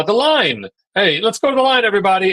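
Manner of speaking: loud, high rallying call to a crowd; mock race-day call to the group. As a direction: shout it to gather everybody. Calling a group to the start.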